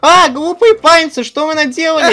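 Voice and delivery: high, loud villain's outrage; angry outburst. Acted. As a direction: shout furiously at the culprits. Злодей обнаружил поджог. (громкий возмущённый крик злодея)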